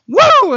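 high, loud woo; short burst of cheering; excited whoop. Acted. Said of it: "They were excited".

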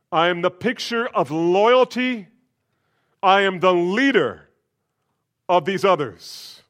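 self-important booming claim; boastful Peter. Acted. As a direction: strut through it like a hero. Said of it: "Puffs up his chest".

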